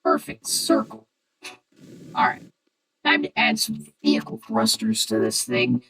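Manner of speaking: through a voice changer. Real, not acted. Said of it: "the cool voice changer voice".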